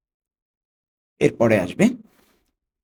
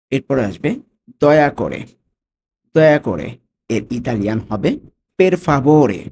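ordinary delivery

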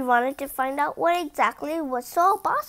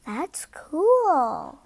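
gasping delight; 2 semitones higher than usual. Real, not acted. Reader reacts to the city.

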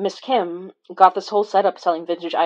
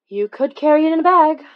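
teasing; 9 semitones higher, 7 dB louder than usual. Retold or imitated. Quoting her own teasing line.

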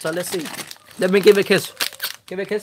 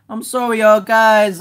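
ordinary talk, nothing marked

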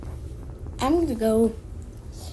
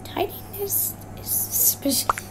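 whispering; 6 semitones higher, 8 dB quieter than usual. Real, not acted. Whispering during Among Us game.

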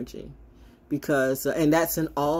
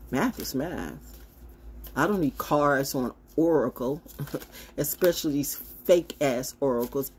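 usual speaking voice

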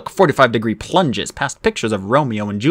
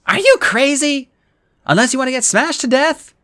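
alarmed Annabeth; 14 semitones higher than usual. Acted. Annabeth objects to Percy's plan, high and alarmed. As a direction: voice her shocked disbelief, pitched up. Annabeth protesting mid-ride.

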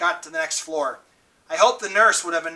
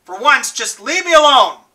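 much higher and louder anguished cry; breaking desperate plea; 8 semitones higher, 10 dB louder than usual. A narrator's reading. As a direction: cry out, voice cracking. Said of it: "His voice broke".

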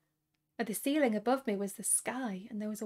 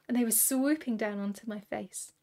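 plain reading voice

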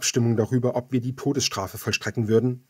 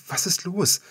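sudden worried lift on the question; alarmed; 8 semitones higher than usual. Acted. Narrator startled as Indigo starts crying, alarmed question. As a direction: ask it startled and concerned.

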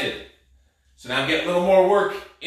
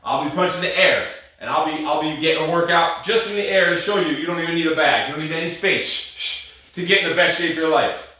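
usual speaking voice

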